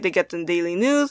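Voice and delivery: ordinary talk, nothing marked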